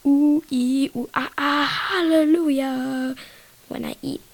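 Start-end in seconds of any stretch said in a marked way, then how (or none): 0.1-3.1 ecstatic pickle noises. Retold or imitated. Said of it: "So it's like".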